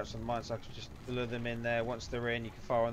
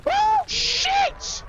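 sudden high yelp of shock; startled yelp; 13 dB louder than usual. Real, not acted. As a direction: jolt out a startled cry. Sudden game scare.

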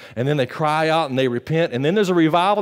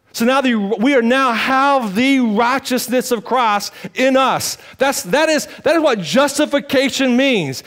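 raised, fervent preaching voice; 9 semitones higher, 4 dB louder than usual. Acted. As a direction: preach it out with conviction. Preaching at full force.

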